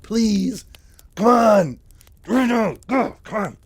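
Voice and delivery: high, loud begging; hammed-up desperate plea; pleading. Acted begging for cigarettes.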